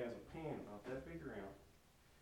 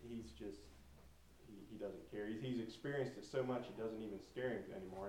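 usual speaking voice

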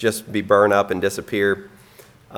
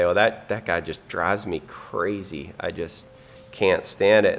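softer, under-the-breath mock gripe; muttered grumble; 3 semitones lower, 6 dB quieter than usual. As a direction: grumble it quietly to yourself. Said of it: "grumbling and complaining".

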